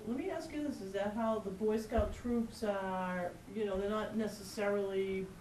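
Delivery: usual speaking voice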